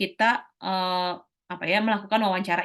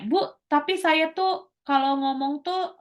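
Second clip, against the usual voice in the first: higher, slow, hesitant student asking the teacher; student asking Ibu a question, higher and slow; shy student asking; 7 semitones higher, slower than usual. Retold or imitated. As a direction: play a timid student raising a worry, slowly. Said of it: "Kemudian ada yang nanya".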